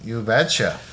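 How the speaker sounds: lightly voiced Minnesota accent; a hint of mock Minnesotan. Answering the co-host's bit in a Minnesota accent. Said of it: "Minnesota's a pretty funny accent".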